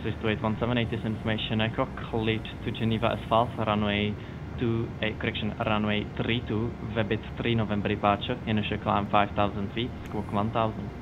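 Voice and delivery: normal talking voice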